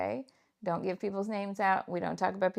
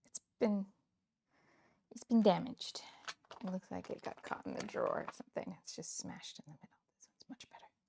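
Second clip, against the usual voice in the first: whisper; 9 dB quieter than usual. Real, not acted. Whispered crafting asides.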